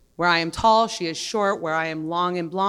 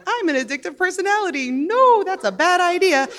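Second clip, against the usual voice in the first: mock high excuse; 9 semitones higher than usual. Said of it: "And I'm like".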